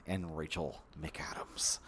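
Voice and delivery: breath running out, voice fading to a whisper. Real, not acted. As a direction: let the name sink away on your last air. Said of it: "out of breath".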